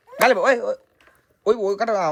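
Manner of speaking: high, quick-fire calls of oy; excited urging. Real, not acted. Vlogger to helper inflating the pillow, excited urging. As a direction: urge them on in a high voice.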